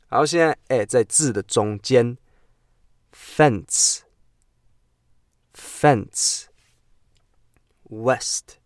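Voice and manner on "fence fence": "fence" drawn out very slowly; slow model word. Shown as a demonstration. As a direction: stretch "fence" for learners to copy. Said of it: "pronunciation drill".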